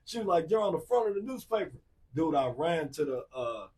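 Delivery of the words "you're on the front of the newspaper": higher, insistent repeat; excited sister. Retold or imitated. Sister repeating the front-page news, excited and higher. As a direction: insist on it again, delighted.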